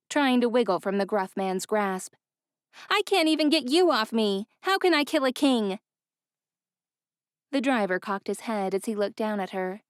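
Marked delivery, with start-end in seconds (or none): 2.9-5.8 Ella. A narrator's reading. Ella protests while pinned.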